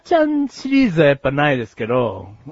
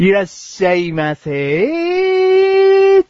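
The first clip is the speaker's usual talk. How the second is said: much higher, very slow throat-pinched welcome; bright loud shop welcome; 14 semitones higher, 3 dB louder, much slower than usual. Shown as a demonstration. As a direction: squeeze it through the throat, long and high. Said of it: "そこ込みのモノマネだったら似てるだろう".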